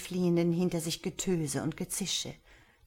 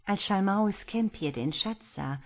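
usual speaking voice

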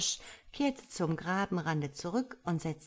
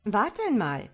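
pondering starling, pitched up; thoughtful; 4 semitones higher, 6 dB louder than usual. A narrator's reading. Starling stalls the complaining frog, thoughtful. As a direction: hold the frog up for a moment's thought. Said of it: "sagte der Star nachdenklich".

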